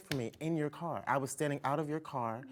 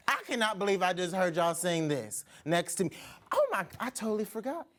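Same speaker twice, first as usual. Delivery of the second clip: ordinary talk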